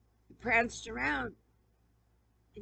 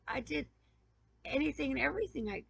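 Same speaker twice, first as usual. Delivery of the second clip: normal talking voice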